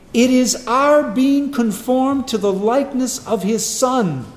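loud, rising sermon climax, hammering each phrase; full-force preaching. Acted. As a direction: preach it out to the back row, full voice.

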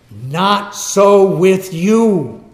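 slow, loud, every word landed separately; forceful, drawn-out rebuke; emphatic. Acted. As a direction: slap them with NOT, SO, WITH YOU. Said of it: "Jesus emphatically states this".

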